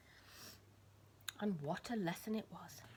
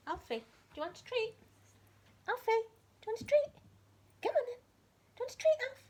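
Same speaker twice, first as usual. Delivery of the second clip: high, bright pet-calling voice; calling the dog; 8 dB louder than usual. Real, not acted. Owner calling Alfie for a treat, high and coaxing. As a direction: coax the dog over.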